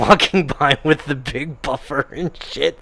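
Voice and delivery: ordinary delivery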